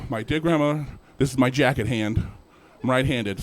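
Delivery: ordinary talk, nothing marked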